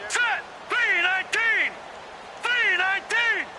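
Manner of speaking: very high shouted signals; high, repeated football cadence shout; quarterback's snap call. Acted. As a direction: holler each call to the whole line.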